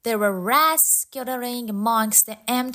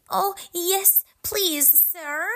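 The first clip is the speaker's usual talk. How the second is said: eager; 10 semitones higher than usual. A narrator's reading. Boy eagerly accepts.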